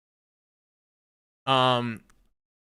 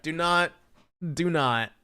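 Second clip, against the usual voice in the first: ordinary delivery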